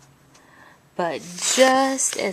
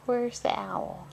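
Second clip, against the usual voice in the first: ordinary delivery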